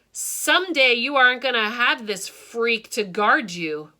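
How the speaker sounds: loud, snarling bully; a mean boy snarling a parting threat. A narrator's reading. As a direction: spit it out as a threat. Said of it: "Barton snarled".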